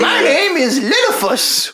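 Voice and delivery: put-on high, slow little old shopkeeper; creaky little old man, pitched high; tiny old man voice. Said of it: "There was a face and everything".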